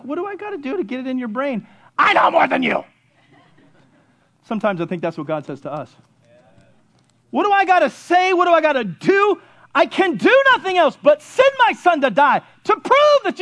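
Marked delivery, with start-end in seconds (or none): 0.0-2.8 mock exasperation bursting into a loud outburst; exasperated parent. Parent retelling arguing with youngster, mock exasperated outburst. 7.3-13.4 high, emphatic pleading; exasperated God. Acted. Preacher voicing God to stubborn people, exasperated pleading.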